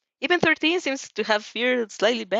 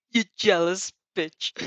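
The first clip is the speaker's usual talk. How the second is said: slow, giggling through the words; words squeezed through laughter; laughing; slower than usual. Real, not acted. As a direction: say it while still laughing. Reader voices joke amid laughter.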